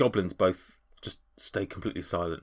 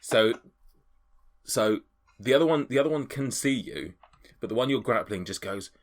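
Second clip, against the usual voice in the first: ordinary delivery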